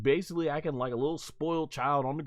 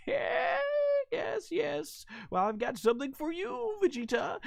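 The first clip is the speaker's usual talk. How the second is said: much higher, softer sneering villain voice; sly villain gloat; 13 semitones higher, 4 dB quieter than usual. Retold or imitated. Villain Neva taunting Vegeta, high sly gloat. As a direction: purr it like a scheming villain. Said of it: "He's like".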